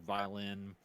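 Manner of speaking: usual speaking voice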